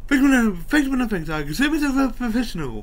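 zany comic voice. Retold or imitated. Jim Carrey character quotes.

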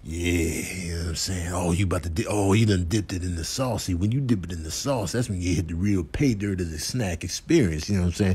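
low, soft snack admirer; quiet, low sly sweet-talk; smooth-talking character. Pretend. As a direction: murmur it low and easy.

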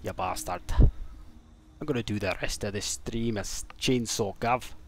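slow, put-on Scottish accent; hammed-up Scotsman. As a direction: stretch it out in a mock Scots accent. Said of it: "That was a good Scotsman accent".

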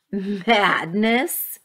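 incredulous, drawn-out rising question; scornful snort. A narrator's reading. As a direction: throw the word back with scorn. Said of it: "Alexander snorted".

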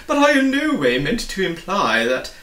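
startled humbug nervously pleading innocence. Acted. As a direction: fluster through it like a pompous bug. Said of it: "insisted the humbug".

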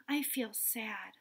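soft, slow, sad telling; sad little voice. Shown as a demonstration. Child telling Mom about a feeling, softly sad. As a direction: sound sad as you tell Mom.

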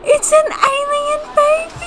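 gasp, then very high excited squeal; thrilled squeal. Real, not acted. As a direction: shriek with delight at the surprise. Sees the alien baby born.

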